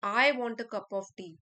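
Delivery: loud, lengthened "I" with the rest stepping down; stress on I. Shown as a demonstration. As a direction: make "I" the strongest word. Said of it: "I पर stress किया है". ("I" पर ज़ोर देकर)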